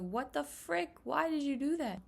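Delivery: high, shocked what the frick; a hurt kid's baffled cry, pitched high; stunned and high; shocked child. Retold or imitated. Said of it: "I was like".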